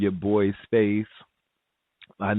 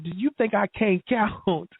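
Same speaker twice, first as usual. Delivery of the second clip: indignant, high and slow; 12 semitones higher than usual. Retold or imitated. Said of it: "I said".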